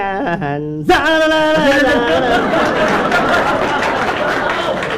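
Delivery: long voiced string-instrument noise; imitated fiddle riff. Wife's ballad accompaniment, mouth-made fiddle interlude.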